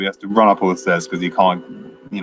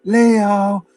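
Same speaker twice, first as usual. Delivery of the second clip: slow, stretched mock shout of a name, much higher; drawn-out yell; 10 semitones higher, much slower than usual. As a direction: drag the name out as you call across the lake. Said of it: "he just starts yelling out".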